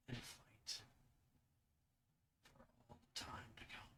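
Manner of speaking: hushed, eerie voice from within; whispery unearthly monster voice; disembodied voice. Acted. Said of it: "it's like a disembodied voice".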